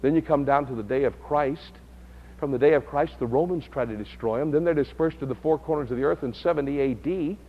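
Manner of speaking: ordinary talk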